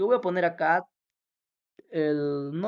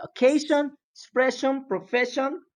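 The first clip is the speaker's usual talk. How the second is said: occasion, expression, profession with the syllable before sion hit higher; stress before sion; 6 semitones higher than usual. Shown as a demonstration. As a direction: model the stressed syllable in each word, pitched up. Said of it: "La mayor fuerza de voz".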